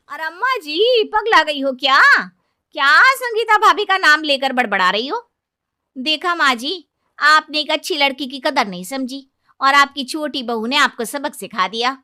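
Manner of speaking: very high and loud jeering; mocking maid. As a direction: maid scolding the confused old woman.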